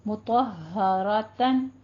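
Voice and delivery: "मुतहरतन" slow, pressing twice on h; deliberate tajweed "मुतहरतन" with double h; firm doubled ha. Shown as a demonstration. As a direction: hold the first h of "मुतहरतन", then release into ha. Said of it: "तो सख्ती के साथ".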